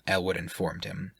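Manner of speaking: usual speaking voice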